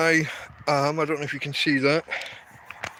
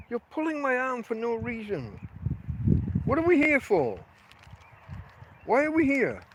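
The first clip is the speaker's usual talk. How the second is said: higher exasperated questions to the dog; 7 semitones higher than usual. Real, not acted. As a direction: ask the dog in exasperated disbelief. Scolding dog, pitched high.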